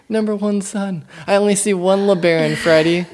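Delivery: high, loud, laughing character voice; movie quote voice. Acted. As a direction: ham up the movie line, laughing. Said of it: "That's from Freddy Got Fingered".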